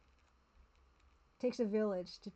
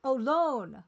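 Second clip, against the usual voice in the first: sudden realization; 3 semitones higher, 8 dB louder than usual. Real, not acted. Suddenly deciphers the word.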